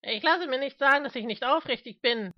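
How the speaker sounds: high, loud indignant outburst; bellowing. Acted. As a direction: bellow your protest, pitched high. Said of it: "brüllte Mister Smith".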